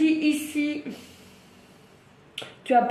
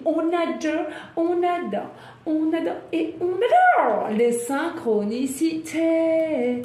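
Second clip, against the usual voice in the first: high, drawn-out singsong delight; excited gushing; 4 semitones higher than usual. Real, not acted. Tarot reader raving to viewers about synchronicities, excited gushing. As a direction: gush it higher and higher, stretching the last words. Excited outburst over the cards.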